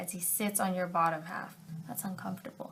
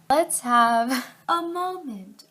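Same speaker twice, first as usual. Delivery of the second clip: higher, louder, slow mock gravity; slow mock-solemn announcement; 4 semitones higher, 6 dB louder, slower than usual. Real, not acted. Reader mock-solemnly pausing the story, slow and louder. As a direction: announce it slowly like a solemn ceremony. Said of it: "mock solemn announcement".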